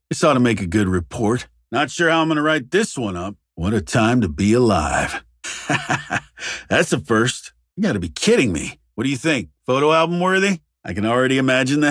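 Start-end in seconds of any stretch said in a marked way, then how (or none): 5.4-6.2 soft, breathy little laugh; amused chuckle. Acted. Investigator at a once-in-a-lifetime sight, amused chuckle. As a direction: chuckle quietly in disbelief.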